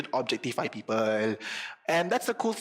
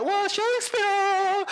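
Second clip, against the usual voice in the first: high, drawn-out mock fan cheer; hammed-up adoring whoop; everybody's awe; 4 dB louder, much slower than usual. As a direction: imitate a crowd gushing, stretched out and very high. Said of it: "everybody, like".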